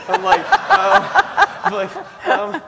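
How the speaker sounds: real laughter breaking through the words, pitched high; laughing. Real, not acted. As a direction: laugh helplessly at your own panic. Laughing retelling of panic.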